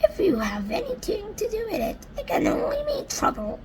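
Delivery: high whiny mutter. Acted. Earthworm muttering at the centipede, high whiny grumble. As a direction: grumble it tearfully. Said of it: "muttered the earthworm".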